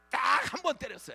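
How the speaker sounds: emphatic declaration. Acted. Pastor insisting to the congregation he hit them only once, loud. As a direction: hammer the point home.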